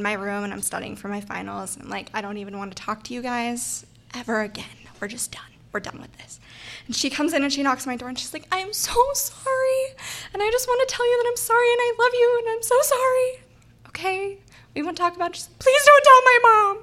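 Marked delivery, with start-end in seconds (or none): 2.1-6.4 exasperated whisper to herself; muttered whisper. Retold or imitated. An RA studying for finals, a fed-up whisper. 8.5-16.8 put-on high, pleading girly voice; gushing apology. A caught girl apologizing to the RA, a high pleading voice.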